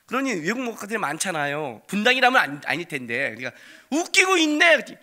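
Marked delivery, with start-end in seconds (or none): 3.9-4.7 high, loud scoffing taunt; mocking jeer. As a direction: sneer at the boy. Friends mocking.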